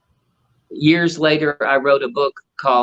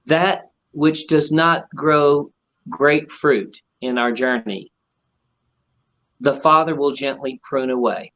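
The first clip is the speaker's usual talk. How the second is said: solemn scripture; slower than usual. Retold or imitated. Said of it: "He said".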